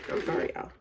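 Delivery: quick whispered aside to the viewers; a soft unvoiced sorry off to the side; whispered apology. Real, not acted. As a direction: hush and apologise under your breath.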